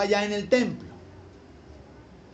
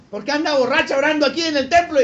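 loud, raised-pitch rebuke; acted scolding; 6 semitones higher, 6 dB louder, faster than usual. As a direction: priest indignantly telling a worshipper off. Priest rebuking woman praying in temple.

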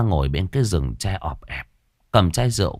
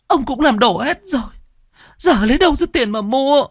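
very high, tearful stammering; trembling stammer. Acted. Beaten wife protests to her husband, trembling stammer. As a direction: stammer out a wailing protest, voice pitched right up. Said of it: "lắp bắp".

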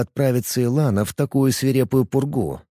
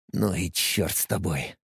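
lower, much softer grumble to himself; muttering under breath; 6 semitones lower, 8 dB quieter than usual. A narrator's reading. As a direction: mutter it into your collar. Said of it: "Бурчу себе под нос".

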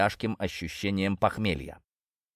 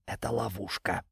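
softer, wary snake voice; quiet serpent warning; 7 dB quieter than usual. A narrator's reading. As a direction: warn it under your breath.

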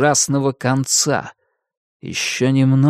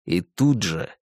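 ordinary delivery

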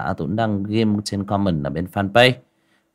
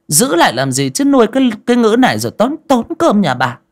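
much higher, louder shrill scolding; harsh shrewish snapping; 10 semitones higher, 9 dB louder than usual. Acted. Fat wife urging husband to beat servant, shrill harsh scolding. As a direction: spit contempt at the useless servant. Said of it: "mụ gằn giọng gắt lên".

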